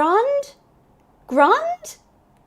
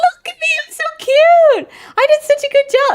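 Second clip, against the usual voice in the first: very high, gushing squeal; high, loud delight breaking into laughter; 7 dB louder than usual. Real, not acted. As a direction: squeal with pride at how cute it is. Delighted with own gnome appearance.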